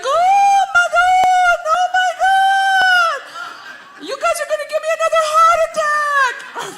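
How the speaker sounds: very high, wailing mother impression; hysterical mother. Comic mimics mother scolding the family, hysterical shrieking. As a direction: shriek it like an overdramatic mom, stretching the cries. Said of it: "And my mother's like".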